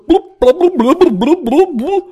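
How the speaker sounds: high, loud nonsense blubbing; mock gibberish dialogue. Critic mimicking the film's baffling dialogue, mock gibberish. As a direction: babble blub syllables as incomprehensible movie talk. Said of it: "det er altså bare sort".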